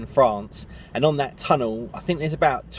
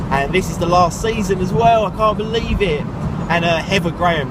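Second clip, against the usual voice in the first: excited; 6 semitones higher, 4 dB louder than usual. Real, not acted. Last season of favourite show.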